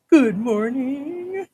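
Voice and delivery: high, slow drawn-out good morning; sing-song greeting. Shown as a demonstration. Sung-out greeting.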